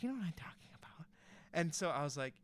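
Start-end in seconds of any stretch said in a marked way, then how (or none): 0.0-1.1 hushed, quick director's hint; imitated director, whispering knowingly; mock confidential whisper. Director hinting at awkward request.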